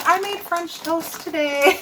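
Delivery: high, slow, lightly voiced toddler; imitating grandson. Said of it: "He's like".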